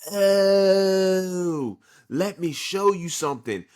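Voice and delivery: high, loud gloating reveal, then settling back; spring the trap on him with a smug grin; lightly voiced smug rival showing off his power; cocky Uryu taunt. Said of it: "Uryu's like".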